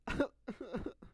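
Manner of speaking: imitated sobs tipping into laughter; mock cry-laugh in a long broken run; crying turning to laughing. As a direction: act out weeping that becomes a laugh. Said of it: "I'll try that one more time".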